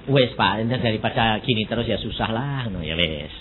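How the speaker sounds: low, fading, giving-up voice; resigned sigh. Retold or imitated. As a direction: sink into resignation. Voicing a resigned family. (pasrah, makin lirih)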